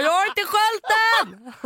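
retelling her alarm to the friend; mock panicked protest. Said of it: "Jag bara".

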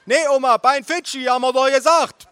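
very high, loud yell; bellowing aunt. A narrator's reading. Aunt correcting grandma over table, bellowing. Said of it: "brüllte meine Tante über den Tisch".

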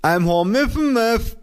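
slow, very high, mushy lisp; mother's muffled voice. A narrator's reading. Mother repeating herself to her child, high muffled lisp. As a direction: repeat it slowly through a swollen mouth. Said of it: "sagte meine Mutter".

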